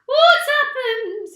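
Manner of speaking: very high, very loud, drawn-out scream. A narrator's reading. Missus Twit sees her upside-down living room, high loud scream. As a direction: scream it in horror, slowly. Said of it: "screamed Missus Twit".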